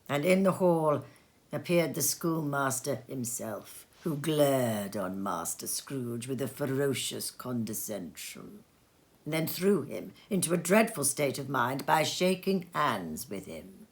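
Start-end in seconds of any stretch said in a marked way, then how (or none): none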